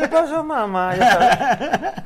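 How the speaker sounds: imita el cantadito chilango entre risas; "¿Qué pasó, mamá?" con tono cantado y subido; acento chilango cantadito. Retold or imitated. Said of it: "Hablan cantadito". (acento chilango cantadito, riendo)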